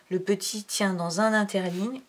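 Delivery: ordinary delivery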